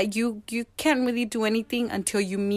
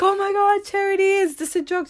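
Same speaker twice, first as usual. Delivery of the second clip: high-pitched retold amazement; excited disbelief; 10 semitones higher than usual. Woman asks social worker if it's real, acted excited disbelief.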